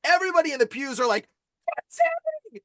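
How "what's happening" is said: very high mock-panicked squeal; startled congregation. Storyteller imitates everybody in the pews, high panicked voice. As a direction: squeak it in hammed-up alarm.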